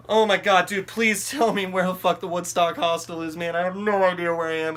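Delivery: loud, high, frantic begging; desperate pleading. Retold or imitated. Begging a stranger for the hostel, desperate pleading. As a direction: act out a panicked plea at full voice.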